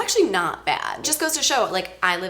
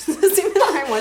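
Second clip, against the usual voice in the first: laughing; 8 semitones higher, 5 dB louder than usual. Real, not acted. Laughing at wrong flavor.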